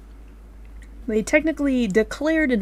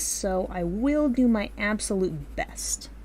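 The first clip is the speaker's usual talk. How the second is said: slow voiceless whisper; slower than usual. Real, not acted. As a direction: whisper the promise slowly. Whispered promise.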